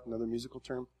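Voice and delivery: usual speaking voice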